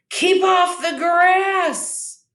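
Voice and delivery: high, slow shout. A narrator's reading. Cactus yelling at a passing cowboy, high slow shout. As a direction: yell it out to chase him off. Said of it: "shouted Hank".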